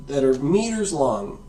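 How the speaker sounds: ordinary delivery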